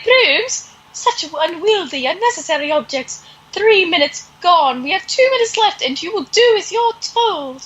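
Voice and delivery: high, haughty Mary Poppins sneer; haughty governess. Retold or imitated. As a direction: look down your nose like a strict nanny. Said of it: "she's like shitty Mary Poppins".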